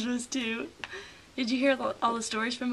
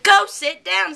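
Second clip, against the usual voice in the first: scolding teacher; 6 semitones higher, 10 dB louder, slower than usual. Retold or imitated. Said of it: "she's like".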